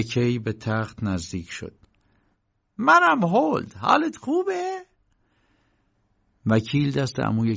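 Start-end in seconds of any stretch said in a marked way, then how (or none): 2.8-4.8 high-pitched uncle greeting a sick friend; much higher voice for the uncle; visiting uncle. A narrator's reading. (صدای زیر عمو در احوالپرسی)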